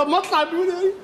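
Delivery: ordinary talk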